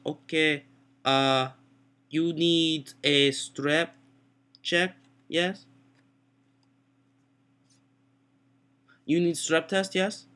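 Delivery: imitated Mexican-accented doctor; a halting doctor with a mock Mexican accent; thick Mexican accent. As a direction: slowly put on a Mexican accent. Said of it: "Mexican accent".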